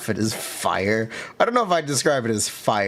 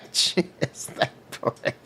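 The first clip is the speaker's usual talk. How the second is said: whispered laugh through words; 3 semitones higher, 4 dB quieter than usual. Real, not acted. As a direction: giggle it out quietly. Laughing after recognizing character.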